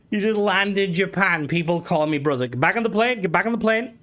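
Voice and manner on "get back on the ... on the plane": high, urgent mock panic; hammed-up alarmed command, pitched high. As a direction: play at ordering a hasty retreat. Acts out fleeing Japan in alarm.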